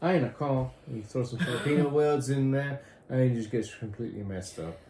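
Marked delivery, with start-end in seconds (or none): none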